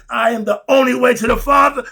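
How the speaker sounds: high, loud declaration; quoting Christ loudly. Retold or imitated. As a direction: proclaim it like a preacher. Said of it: "Didn't Christ tell you".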